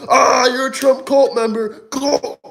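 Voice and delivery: high, put-on screeching voice; a high, mocking caricature voice chanting cult; mock liberal scream. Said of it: "they scream".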